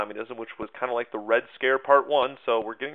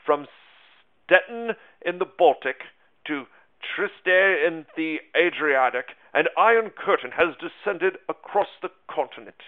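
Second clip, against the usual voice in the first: higher, louder mock-British quote; not-so-good British accent; 5 semitones higher, 5 dB louder than usual. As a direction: read it grandly in a clumsy British accent. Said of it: "not-so-good British accent".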